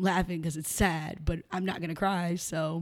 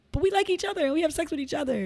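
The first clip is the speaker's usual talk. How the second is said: high, fast, louder mock protest; acting out a baffled objection; retold protest; 11 semitones higher, 5 dB louder, faster than usual. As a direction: object in a puzzled rush, pitched way up. Retelling couple's protest.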